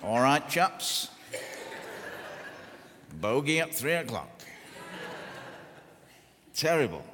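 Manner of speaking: a hint of a Battle of Britain flying ace; playful mock RAF pilot. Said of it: "come off a Spitfire after the Battle of Britain".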